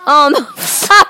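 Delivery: laughing through a curse. Real, not acted. Laughing about Paul Walker.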